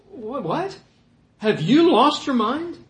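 high, disbelieving questions; incredulous. Acted. Preacher voices the other apostles to Peter, incredulous. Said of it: "apostles are probably like".

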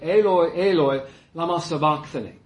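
reciting the cry from the cross in Aramaic; fancy Aramaic. Shown as a demonstration. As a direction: give the Aramaic a fancy ring. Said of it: "real sounds fancy when you say it that way".

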